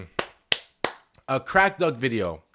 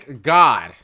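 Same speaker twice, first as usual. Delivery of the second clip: loud relief; 4 semitones lower, 10 dB louder than usual. Real, not acted. Reacting loudly to video.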